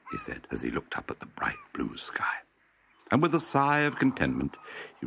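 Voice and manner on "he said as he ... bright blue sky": soft hushed storytelling whisper; whispered narration. A narrator's reading. Narrator whispering to the children about the sky. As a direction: breathe the line like a secret.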